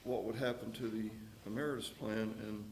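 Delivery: ordinary talk, nothing marked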